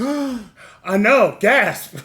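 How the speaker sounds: loud, high mock astonishment; mock shock. Host jokes about revealing his skin tone, mock shock. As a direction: exclaim it in hammed-up shock.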